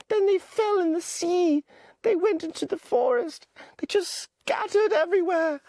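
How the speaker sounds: put-on high, pleading boy voice; high-pitched anxious boy Piggy; Piggy's voice. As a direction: voice the fretful schoolboy Piggy, pitched well up. Piggy continues defending himself.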